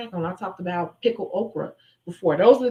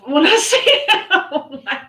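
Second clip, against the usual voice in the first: high, louder, laughing through words; real amused laughter; 7 semitones higher, 8 dB louder, slower than usual. Real, not acted. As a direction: giggle as you talk. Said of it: "start laughing".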